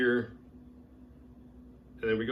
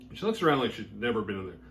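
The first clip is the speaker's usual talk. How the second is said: ordinary talk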